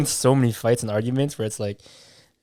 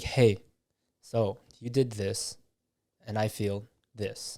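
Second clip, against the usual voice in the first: soft and slow, much quieter, calm and non-blaming; very gentle; 7 dB quieter, slower than usual. Shown as a demonstration. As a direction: ease the words out gently, no accusation. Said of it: "talking gent-, like, very gentle".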